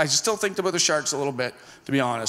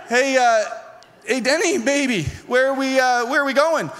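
uneasy questioning; 5 semitones higher than usual. Retold or imitated. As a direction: play the hesitant passenger fishing for answers. Nervous passenger questions boat captain.

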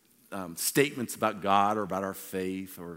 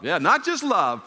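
louder and higher, stress on just; emphatic correction; 8 semitones higher, 7 dB louder than usual. Acted. Preacher insisting on sweet love over plain love, emphatic. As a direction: punch "just" hard and high.

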